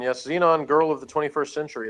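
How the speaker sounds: ordinary talk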